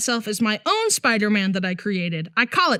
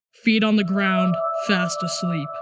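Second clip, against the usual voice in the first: low spooky voice; 6 semitones lower than usual. Retold or imitated. Shady guy's spell.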